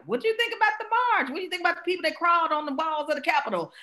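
high-pitched and rushed, a mocking dinner-party voice; mock dinner chatter. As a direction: send up a chatty dinner guest. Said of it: "talking about".

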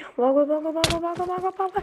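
rapid repeated urging, climbing in pitch; panicked urging. Pretend. Gamer flees danger, chanting run in rising panic. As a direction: urge escape, pitch rising as you go. Fleeing in the game.

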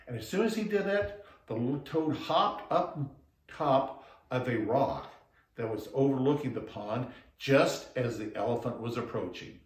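ordinary delivery

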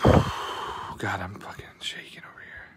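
real shaky-nerved whisper after a sigh; nervous whisper. Real, not acted. Streamer tense over a valuable pack, whispering. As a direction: breathe it out, barely voiced.

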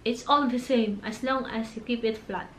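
ordinary delivery

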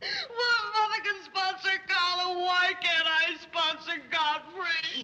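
put-on sobbing complaint; tearful wail. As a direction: wail it like a thwarted child. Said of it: "darling, don't cry".

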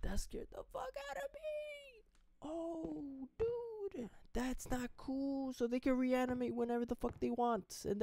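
squeaky rattled complaint; shaken high voice. Real, not acted. Gamer still rattled after the scare, squeaky. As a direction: squeak out the shock.

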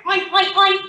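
playful staccato oinking; pig oinks. Acted. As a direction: oink like a pig for a toddler. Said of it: "The pig says".

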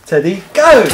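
loud call sending the dog off; excited shout to the dog. Real, not acted. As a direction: launch him after the ball. Owner sends dog after ball.

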